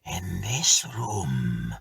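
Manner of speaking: very slow, eerie spirit voice; ghostly voice. Acted. Ghost telling its tale, very slow. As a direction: stretch it out slow and otherworldly. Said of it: "replied the apparition".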